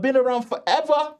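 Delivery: mock high squeal. Mock exaggerated drawl.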